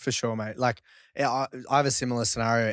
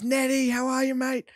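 much higher, cheery voice for the one-armed man; imitating Denny; 13 semitones higher than usual. Greeting the driver from the corner, high cheery imitation. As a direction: play the homeless mate calling out a greeting. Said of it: "And he goes".

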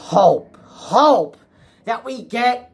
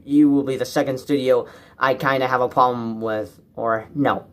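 usual speaking voice

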